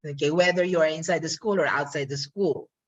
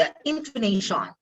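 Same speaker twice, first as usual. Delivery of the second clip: ordinary talk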